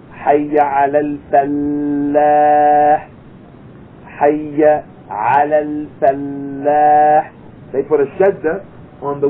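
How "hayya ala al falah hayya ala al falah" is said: "falah" with a doubled L, slow adhan chant. Shown as a demonstration. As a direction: chant "Hayya ala al falah" pressing the double L. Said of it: "people who say".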